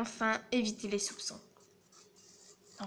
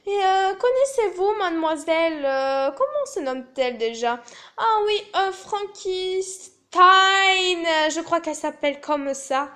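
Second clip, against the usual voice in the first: haute et forte, hésitation très jouée; étirer le nom en feignant de le chercher; fausse innocence; 6 semitones higher, 5 dB louder than usual. Acted. (innocence feinte et surjouée)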